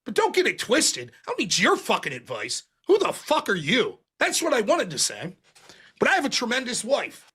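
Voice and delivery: slow, played at normal speed after a sped-up take; normal speed playback. Real, not acted. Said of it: "I have it sped up".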